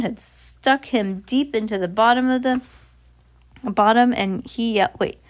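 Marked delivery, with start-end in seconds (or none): none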